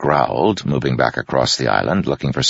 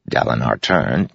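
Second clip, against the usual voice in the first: ordinary delivery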